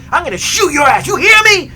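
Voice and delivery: high, loud threatening yell; imitated threats. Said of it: "he's still making threats".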